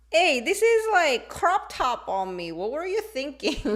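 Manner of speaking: loud, high-pitched protest; mock indignation. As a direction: complain in exaggerated disbelief. Acted scolding of mom over clothes.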